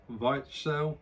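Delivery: "Weichsel" with a V, rhyming first part with "eye"; tentative German pronunciation. Shown as a demonstration. Reviewer apologising to Germans, tentative "Weichsel" said VYTCH-sel. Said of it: "Not the best pronunciation".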